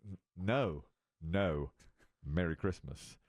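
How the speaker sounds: low, firm, drawn-out Merry Christmas; insistent client. Retold or imitated. Valued client insists on Merry Christmas, low and firm. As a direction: correct him, low and stubborn. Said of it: "And he goes".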